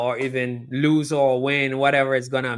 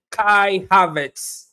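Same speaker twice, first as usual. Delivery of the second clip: drawn-out, louder, higher player announcement; announcer name call; 7 semitones higher, 6 dB louder, much slower than usual. Real, not acted. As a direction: stretch the name out for the crowd. Announcing player being substituted.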